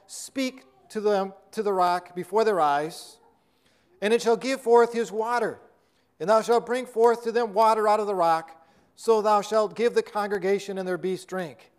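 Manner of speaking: measured, solemn King James reading; scripture reading. Acted. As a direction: read the verses like holy writ.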